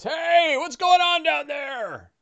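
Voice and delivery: high, slow shout from above; calling from upstairs. Acted. Captive man shouting downstairs from a bedroom, high and slow. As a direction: call down from a distant room. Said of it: "you hear a voice that says".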